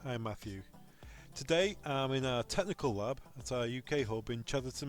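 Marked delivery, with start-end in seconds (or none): none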